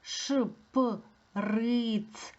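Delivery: ШПРИЦ pulled apart sound by sound, very slowly; slow, segmented teacher demonstration. Shown as a demonstration. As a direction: stretch ШПРИЦ into separate pieces. Word sounded out, then said whole.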